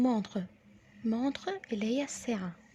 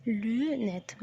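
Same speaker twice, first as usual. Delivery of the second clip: ordinary talk, nothing marked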